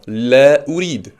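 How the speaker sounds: La urid drawn out very slowly; very slow model. Shown as a demonstration. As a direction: stretch La urid for the learner. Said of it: "on avait vu aussi la urid". (très lentement, en étirant La urid)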